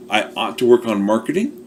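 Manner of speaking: ordinary talk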